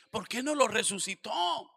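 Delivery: high, loud, slow taunt; scornful shout. Retold or imitated. Pastor voices critics jeering at Jesus, shouting. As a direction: throw the question out as an accusation.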